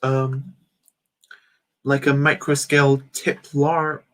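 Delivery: ordinary talk, nothing marked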